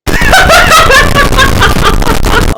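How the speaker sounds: loud, high, long cackle; huge derisive laughing fit; mocking outburst of laughter. Real, not acted. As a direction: laugh hard and high in their face. Derisive reaction to the replayed clip.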